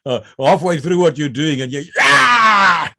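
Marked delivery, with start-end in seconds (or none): 2.0-2.9 high, loud comic war yell; acted roar, held out; battle cry. Trainee attacking with pants round ankles, hammed-up battle cry.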